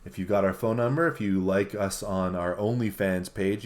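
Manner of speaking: normal talking voice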